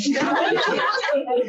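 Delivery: delighted laugh; real laugh. Real, not acted. Laughing at the shadow question.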